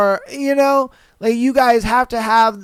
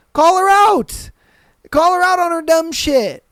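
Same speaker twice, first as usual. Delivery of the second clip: much higher and louder command; emphatic shout; 6 semitones higher, 4 dB louder than usual. Real, not acted. Telling viewers to confront coworker, loud command. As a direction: bark the advice at them.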